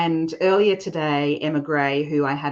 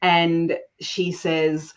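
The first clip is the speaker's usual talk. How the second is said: ordinary delivery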